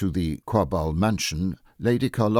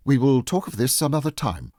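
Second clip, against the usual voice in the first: hurried and higher, cutting the topic off; hasty; 7 semitones higher than usual. A narrator's reading. Mrs Quabarl hastily changing the subject at dinner, flustered. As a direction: brush it aside quickly, flustered. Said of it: "said Missus Quabarl hastily".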